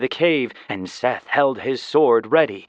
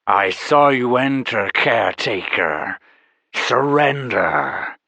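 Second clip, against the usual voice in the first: loud, wet, many-voiced hiss of a call; slithering monster summons; slithery dragon voice; 4 dB louder than usual. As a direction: call out like a drooling serpent. Dragon keeps voice.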